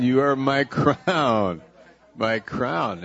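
slow and heartfelt. Shown as a demonstration. Pastor shows how to tell a neighbour, slow and heartfelt. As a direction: say it slowly, like a blessing. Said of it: "Say that to".

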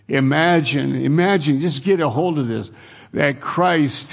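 fervent preacher urging the hearers; impassioned preaching. Acted. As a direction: plead with the congregation to grasp it. Rising into preaching.

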